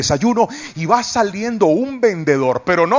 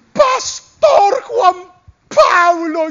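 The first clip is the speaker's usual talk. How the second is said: high, slow, louder shriek of the name; excited fan's cry; 3 dB louder, much slower than usual. Retold or imitated. Resort lady recognizing the pastor, thrilled high shriek. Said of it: "Y me dice".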